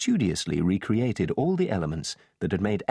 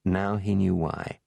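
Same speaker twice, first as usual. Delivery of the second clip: usual speaking voice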